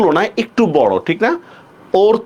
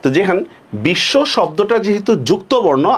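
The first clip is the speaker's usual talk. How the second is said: usual speaking voice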